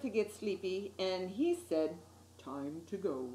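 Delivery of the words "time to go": gentle fish voice. A narrator's reading. Gentle fish sends him home.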